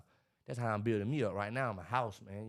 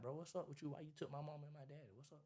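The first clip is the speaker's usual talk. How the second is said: very soft, raised-pitch questioning; retold plea, far under usual volume; hushed complaint; 4 semitones higher, 12 dB quieter than usual. Asking the man upstairs about his parents, hushed complaint.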